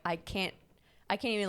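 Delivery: ordinary talk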